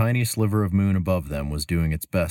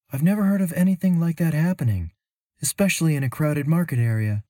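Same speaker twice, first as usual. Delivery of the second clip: put-on high, lifted young woman's voice; Mira's voice; 11 semitones higher, 4 dB louder than usual. A narrator's reading. Traveler telling her companion she's puzzled, in a high worried voice. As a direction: voice Mira up high, puzzled and uneasy. Mira speaks.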